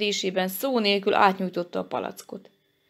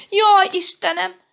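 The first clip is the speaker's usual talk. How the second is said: high, loud cry of dismay; panicked high-pitched exclamation; frightened; 11 semitones higher, 7 dB louder than usual. A narrator's reading. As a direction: wail in sudden alarm. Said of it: "ijedt meg Janka".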